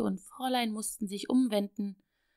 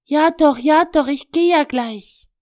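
loud, higher, exasperated outburst; blurted out; 6 semitones higher, 15 dB louder than usual. Acted. Little girl snapping at her doll, loud. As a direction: burst out at the nagging doll. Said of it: "stieß Annemarie hervor".

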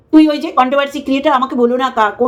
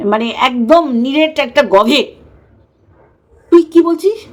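ordinary talk, nothing marked

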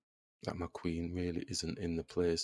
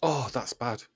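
ordinary talk

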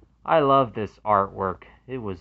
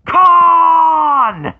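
long high roaring shout of the name; yelled movie line; 17 dB louder than usual. Retold or imitated. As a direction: scream the villain's name with full rage. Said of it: "where he yells".

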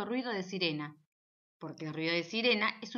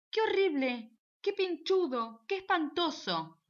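frightened gasps; 7 semitones higher, 3 dB louder, slower than usual. Acted. Sparrow frightened by mouse.

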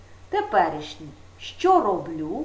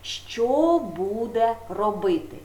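що stressed, slow що буде робити; slow question; slower than usual. Shown as a demonstration. Teacher stressing the future tense question for pupils, slowly. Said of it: "майбутній час має питання".

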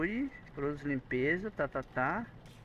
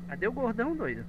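alarmed high question; 7 semitones higher than usual. Real, not acted. Alarmed search.

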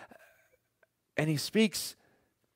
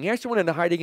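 usual speaking voice

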